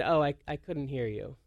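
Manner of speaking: low, soft, sheepish reply; quiet. Retold or imitated. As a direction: imitate a meek apology, dropped in pitch and volume. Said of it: "then just got really quiet".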